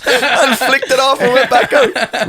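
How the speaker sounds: high, slow words through laughter; can hardly finish for laughing; cracking up at his brother's sleepwalking. Real, not acted. Laughing while telling the story.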